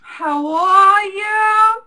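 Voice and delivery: high, drawn-out put-on accent as Hazel; Hazel's pretend accent. Host greeting viewers in Hazel's put-on accent. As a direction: sing out the greeting in a fake accent. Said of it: "Hazel's, uh, pretend accent".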